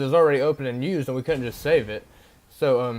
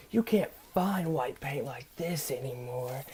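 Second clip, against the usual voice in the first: much softer, hushed stranger voice; imitating the paint guy; 7 dB quieter, slower than usual. Teller imitates the man at the dumpster, hushed and soft. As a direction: half-whisper him like a spaced-out drifter. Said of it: "I swear this is how he talks".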